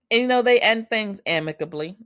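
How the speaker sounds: high, loud gushing delivery; excited. Real, not acted. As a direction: gush about it brightly. Gushing over a nice breakup.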